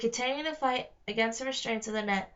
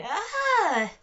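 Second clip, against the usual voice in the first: sudden high yelp of fright; a sharp high cry as the trap snaps; startled scream; 10 semitones higher, 6 dB louder than usual. Acted. Connor yanked into the air by a net, sudden scream. Trap springs, twins jerked upward.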